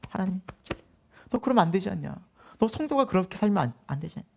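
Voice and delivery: soft, gentle mock reproach; voice of conscience. Conscience reproving a believer, hushed chiding. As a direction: chide softly, as an inner voice.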